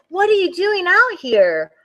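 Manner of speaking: put-on curious creature asking the boy; reindeer voice. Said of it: "said the reindeer".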